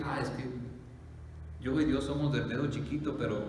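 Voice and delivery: ordinary delivery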